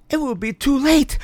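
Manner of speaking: high, pained wail; weeping. A narrator's reading. Mother weeping for her trapped baby. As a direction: say it as you weep. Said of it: "wept Missus Smith".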